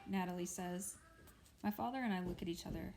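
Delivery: ordinary delivery, nothing marked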